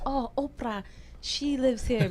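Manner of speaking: lightly mock Italian townsperson; Italian accent. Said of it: "My Italian accent is horrible".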